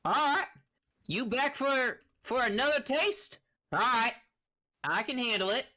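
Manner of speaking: high, loud, gruff captive; gruff man. Acted. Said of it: "a gruff man kinda go".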